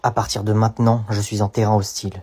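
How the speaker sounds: voix off grave et lente; voix de bande-annonce. Acted. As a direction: annoncer comme un film d'action. (narrateur de bande-annonce, voix grave)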